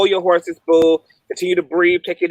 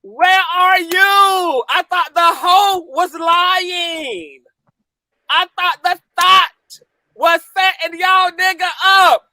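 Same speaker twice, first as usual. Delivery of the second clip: gloating taunt; 6 semitones higher than usual. Real, not acted. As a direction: rub it in their faces. Taunting the defendant's fans.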